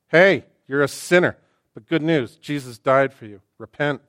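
blunt, loud street preacher; a loud no-nonsense preacher call; harsh preacher. Acted. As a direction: bark it at the sinner.

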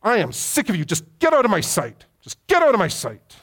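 angry. Retold or imitated. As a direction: yell it at someone you can't stand. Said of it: "That's anger".

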